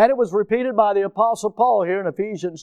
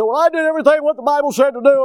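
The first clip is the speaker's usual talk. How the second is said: mock protest; 7 semitones higher, 5 dB louder, much faster than usual. Acts out protesting believers.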